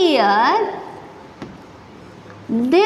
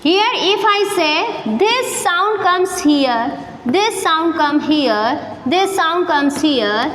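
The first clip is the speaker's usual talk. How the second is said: ordinary talk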